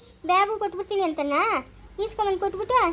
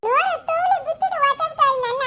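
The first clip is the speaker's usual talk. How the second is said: put-on giggly child voice; 6 semitones higher than usual. Child voice with laugh.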